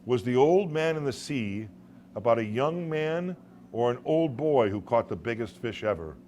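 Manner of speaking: ordinary delivery, nothing marked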